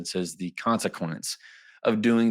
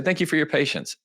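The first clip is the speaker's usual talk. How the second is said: higher, lightly voiced recipient; recipient's reply; 7 semitones higher than usual. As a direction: toss it back, higher and lighter. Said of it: "they're gonna say".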